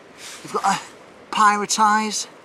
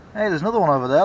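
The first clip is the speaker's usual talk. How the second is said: usual speaking voice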